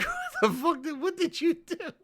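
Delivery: high, incredulous retold question through laughter; squeaky laughing mock outrage; laughing disbelief. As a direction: crack up while asking in mock bafflement. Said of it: "I'm like".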